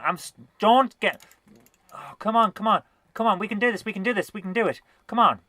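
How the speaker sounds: tense, rising come on come on; urgent encouragement. Real, not acted. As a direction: urge yourself on under pressure. Urging herself on in game.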